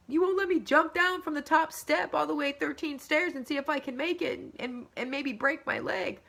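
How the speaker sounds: put-on high-pitched whiny child tantrum; mock tantrum, high and whining; crying, screaming kid. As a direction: imitate a kid wailing a complaint. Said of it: "can be crying and screaming".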